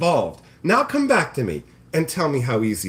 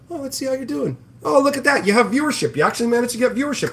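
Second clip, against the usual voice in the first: much higher, mock-amazed voice; mock impressed; 9 semitones higher, faster than usual. Streamer retelling watching a wannabe grow, mock-impressed high voice. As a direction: feign surprise, faster at the end.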